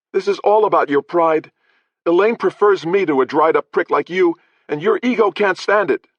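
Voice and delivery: high, loud defiant jeer; furious taunt. Acted. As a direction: throw the insult in his face. Prisoner taunting the lord.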